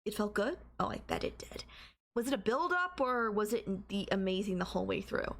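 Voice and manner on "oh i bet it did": hushed, unvoiced purr; whisper. Girlfriend whispers to her violent boyfriend, hushed. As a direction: breathe it right into his ear.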